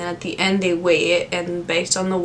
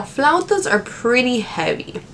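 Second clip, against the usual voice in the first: ordinary talk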